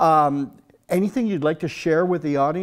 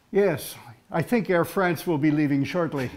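usual speaking voice

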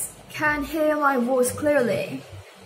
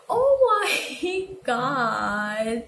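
retold dismay; much slower than usual. Her drawn-out reaction, retold with laugh.

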